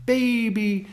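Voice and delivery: very high kissy coo; sweet nothings. Retold or imitated. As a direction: pucker up and coo it. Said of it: "looks at you with a kissy face going".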